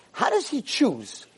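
high, drawn-out question; pained questioning. Retold or imitated. Divorced girl questions Hashem's choice, pained questioning. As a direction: plead slowly for an answer.

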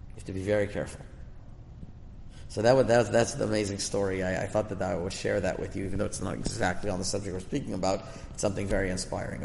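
ordinary talk

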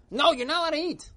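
high, loud scolding shout; upset. Acted. Crowd scolds old man on fast day, upset shout. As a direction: shout the ban in outrage. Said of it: "they were all upset".

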